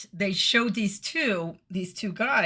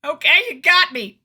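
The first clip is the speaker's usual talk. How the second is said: much higher and louder, a mock caught-out wail; hammed-up confession; 10 semitones higher, 12 dB louder than usual. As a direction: overplay the guilty admission, slowing at the end. Imagined confession to the cop.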